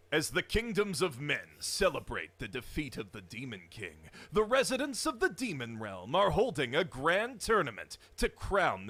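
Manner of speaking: booming movie trailer narration. Pretend. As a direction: epic announcer voice, loud and grand. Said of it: "trailer voice is murder on my".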